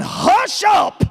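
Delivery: high sharp shout; parents yelling. Acted. Parents shouting at noisy kids in the wagon, high sharp shout. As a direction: snap it out like a fed-up parent. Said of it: "the mamas and the daddies were yelling".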